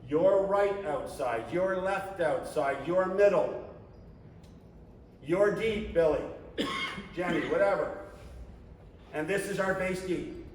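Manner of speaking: usual speaking voice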